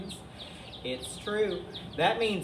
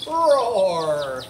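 loud drawn-out mock dinosaur roar; monster roar; 3 semitones higher, 9 dB louder than usual. Tyrannosaurus roars at Edna, loud stretched roar. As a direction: roar it big for the kids. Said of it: "he roared".

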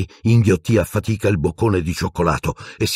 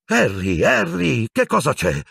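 higher-pitched, agitated questioning; 10 semitones higher than usual. A narrator's reading. Minister to Harry bursting in on him, agitated. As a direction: call the name twice, rising into agitation. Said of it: "disse Fudge agitato".

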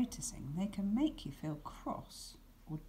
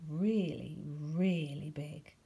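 slow whispered really big; hushed emphasis; 3 semitones lower, slower than usual. A narrator's reading. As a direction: stretch it out in awe, hushed. Cloud looks big.

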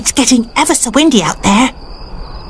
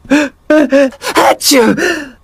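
sneeze; 2 semitones higher than usual. Acted. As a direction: gasp then big sneeze. [name] catching a cold.

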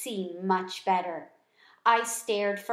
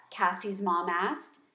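plain reading voice

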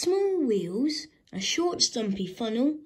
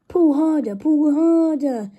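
puffing; 7 semitones higher, 9 dB louder than usual. A narrator's reading. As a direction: strain it out like a toiling engine. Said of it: "puffed Thomas to Gordon".

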